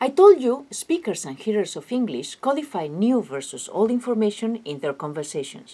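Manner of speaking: ordinary talk, nothing marked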